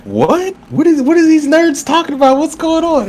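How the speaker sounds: high, squeaky confused voice; a baffled outsider hearing nerd talk; mock confused outsider. Friend mimics outsiders baffled by anime talk, high squeaky confused voice. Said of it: "everybody be like".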